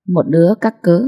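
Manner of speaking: ordinary delivery, nothing marked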